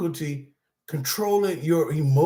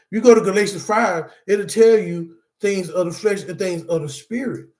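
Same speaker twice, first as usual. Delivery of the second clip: louder preacher's call; preaching; 5 dB louder than usual. Real, not acted. Speaker to support group, preaching the verse. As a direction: drive the scripture home like a sermon. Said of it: "I don't know why I'm preaching like this".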